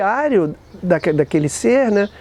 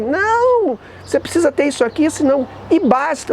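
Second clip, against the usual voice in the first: high, dismissive refusal; curt clerk; 8 semitones higher than usual. Retold or imitated. A post office clerk refusing him, high and curt.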